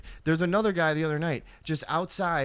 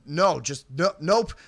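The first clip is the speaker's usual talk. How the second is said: firm shooing; 3 semitones higher, slower than usual. Retold or imitated. Shooing the man away again.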